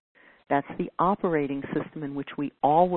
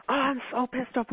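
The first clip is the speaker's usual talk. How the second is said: mock pissed-off rant; 8 semitones higher than usual. Acted angry inner voice.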